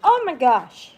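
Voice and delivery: sudden loud high oh my gosh; loud surprised exclaim. Real, not acted. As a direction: blurt it out in surprise.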